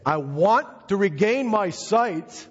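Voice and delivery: slow, high-pitched ringing plea; emphatic declaration. Acted. Blind man telling Jesus his exact request, emphatic. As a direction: declare each word slowly, pitched high. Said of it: "What did he say?".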